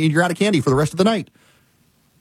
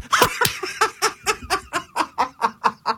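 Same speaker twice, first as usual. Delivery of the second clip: high-pitched staccato laughing fit; real high cackling laugh; helpless laughter; 4 dB quieter than usual. Real, not acted. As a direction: crack up in a long, high, rattling laugh. Cracking up at Claude's reply.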